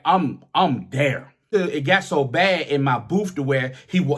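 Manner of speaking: ordinary delivery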